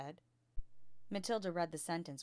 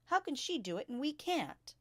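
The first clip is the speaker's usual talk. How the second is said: put-on high, eager little girl asking; child voice; 9 semitones higher, 5 dB louder than usual. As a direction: pipe up like an indignant schoolgirl. Child protests in class.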